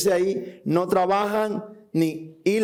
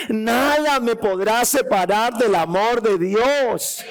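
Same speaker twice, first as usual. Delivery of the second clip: preaching climax; 2 semitones higher than usual. Acted.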